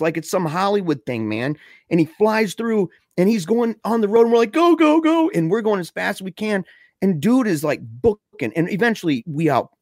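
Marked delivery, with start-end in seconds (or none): 4.5-5.3 high, loud frantic urging; mock-panicked holler, pitched way up; urgent getaway yell. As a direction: shout it like you're being chased. Friends urging the getaway driver.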